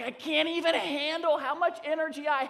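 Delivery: bouncing golden retriever voice; overexcited dog. Retold or imitated. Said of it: "His body's like".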